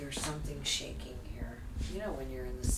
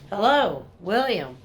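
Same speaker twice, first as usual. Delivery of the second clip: loud, higher call into the dark; calling out to a ghost; 5 semitones higher, 12 dB louder than usual. Real, not acted. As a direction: call out loudly to a spirit. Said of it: "Speak to him".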